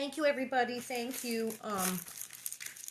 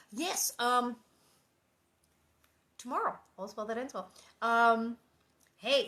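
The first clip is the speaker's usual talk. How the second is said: plain reading voice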